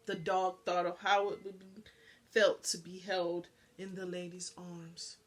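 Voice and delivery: ordinary delivery, nothing marked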